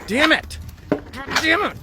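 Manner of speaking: rising frustration. Acted. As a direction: let it build up. Stuck, curses building.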